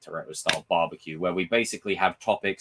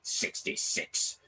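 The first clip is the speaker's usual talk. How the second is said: soft, slow, breathy sinister rasp; Palpatine voice; 6 semitones lower, 7 dB quieter, slower than usual. Retold or imitated. Palpatine in his chamber giving the order, slow sinister rasp. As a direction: hiss it like the Emperor. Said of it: "sitting there in his little chamber going".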